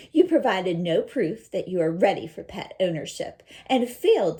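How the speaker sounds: firm and loud, like a judge; mother delivering a firm, loud ruling; strong firm voice. Acted. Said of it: "I read it with a strong voice".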